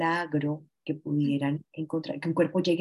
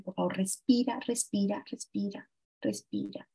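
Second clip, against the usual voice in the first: begging softer and softer until almost silent; pleading, fading away; 2 semitones higher, 5 dB quieter than usual. Retold or imitated. Mother begging her drowned daughter to breathe, fading plea. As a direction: plead breathe, voice dying to nothing.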